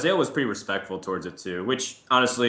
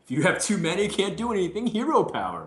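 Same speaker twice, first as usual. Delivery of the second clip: much higher quoted explanation; imitating expert player; 11 semitones higher than usual. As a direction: put on the expert's high-pitched explaining voice.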